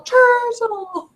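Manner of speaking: high, drawn-out comic cry; mock film quote. Friends quoting a favourite film line, high comic cry. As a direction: squeal it out as a movie catchphrase.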